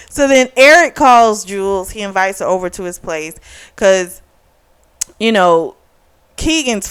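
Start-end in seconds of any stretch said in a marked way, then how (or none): none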